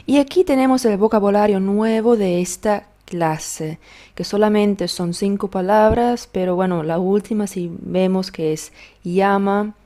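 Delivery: usual speaking voice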